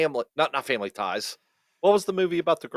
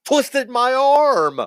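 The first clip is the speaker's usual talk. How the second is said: high, loud, drawn-out protest; mock-outraged cry; 13 semitones higher, 5 dB louder, much slower than usual. Real, not acted. Blaming friend for the bad movie, mock-outraged yell. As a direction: wail it out slowly in mock outrage. Mock complaint about being talked into it.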